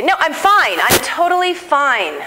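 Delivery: mock a parent brushing it off, slowly; fake fine parent. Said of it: "literally their mom or dad would say".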